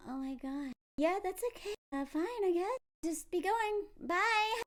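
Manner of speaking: small high voice, trailing away; acted hurt retreat. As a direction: imitate a rejected woman bowing out. Acting out rejected woman's reply.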